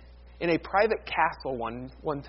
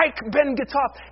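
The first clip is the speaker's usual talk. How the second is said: higher-pitched, insistent outburst as Luther; defiant German cry; 7 semitones higher than usual. Acted. Luther answering the urge to quit, defiant cry. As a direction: cry it out against the temptation. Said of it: "Every time this temptation came to him".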